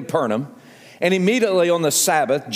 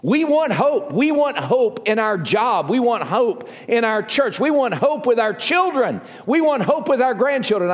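higher-pitched, insistent preacher's litany; impassioned preaching; 8 semitones higher than usual. Real, not acted. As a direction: preach each repeated phrase with rising urgency.

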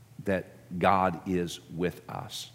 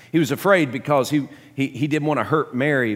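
afraid hit higher and louder, afraid ringing out; louder, higher repeat; 7 semitones higher, 9 dB louder than usual. Shown as a demonstration. Preacher driving the point home, afraid loud and high. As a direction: build the word afraid up hard and loud. Same words, now pushed out.

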